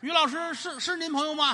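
a doctor suddenly realizing who the patient is; startled doctor. Acted. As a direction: jump up in shock, stammering. Doctor shocked to learn patient.

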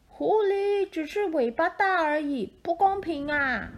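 high, whiny wolf voice. Acted. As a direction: complain like a sulky wolf. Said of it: "狼说".

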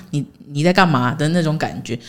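usual speaking voice

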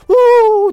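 high, loud happy whoop; child's loud happy squeal rushing to the door; excited squeal. Retold or imitated.

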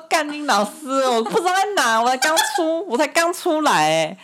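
high pitched, half-laughing indignant outburst; porter's high, laughing mock outrage at the request; mock outraged disbelief. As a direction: squeak out his shocked protest. Retold porter swearing in disbelief.

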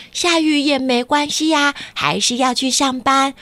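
ordinary delivery, nothing marked

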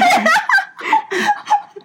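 high helpless laughing fit; burst of laughter. Real, not acted. Aunt cracking up while retelling the story, high laughter. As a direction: laugh hard at the memory.

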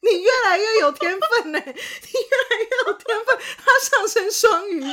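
high, loud, laughing teasing; thrilled cackling praise; excited laughing praise. Real, not acted. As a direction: gush at the partner through laughter.

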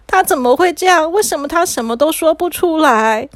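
high-pitched, upset questioning; distressed disbelief. A narrator's reading. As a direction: narrate with dismayed bewilderment. Boy's distress at losing speech.

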